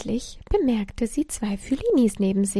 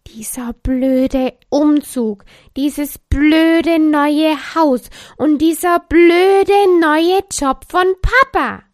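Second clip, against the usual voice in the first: loud, slow, higher furious rant; wütendes Schimpfen; 6 semitones higher, 13 dB louder, slower than usual. A narrator's reading. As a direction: spit out each blöde. Lisa schimpft über den Umzug. (Lisa schimpft laut und langsam über den Umzug)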